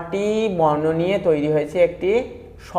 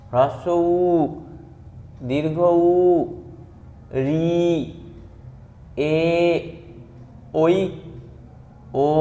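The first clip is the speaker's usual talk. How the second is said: alphabet recitation. Shown as a demonstration. Teacher to pupils, reciting the vowels and consonants in a chant. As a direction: count off each letter like a classroom drill.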